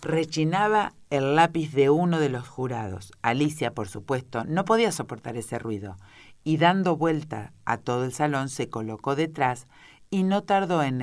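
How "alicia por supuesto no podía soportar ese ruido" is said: ordinary delivery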